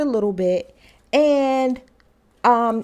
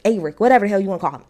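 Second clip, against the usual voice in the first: ordinary delivery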